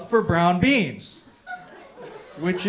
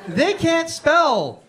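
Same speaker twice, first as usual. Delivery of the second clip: drawn out slowly and pitched higher, mocking; slow mocking emphasis; 8 semitones higher, much slower than usual. Roasting Boston to the crowd, slow, high mocking drawl. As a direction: stretch it out like it's obvious.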